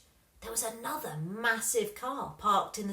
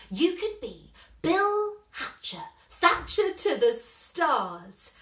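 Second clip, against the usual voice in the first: grand announcer voice; 7 semitones higher, 7 dB louder, slower than usual. Acted. Lily imagining Dad's Hollywood title.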